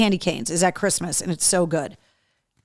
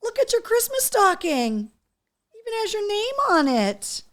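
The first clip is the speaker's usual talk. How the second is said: high pet-talk voice; 12 semitones higher than usual. Real, not acted. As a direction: talk high and sweet to the dog. Owner talking to her dog.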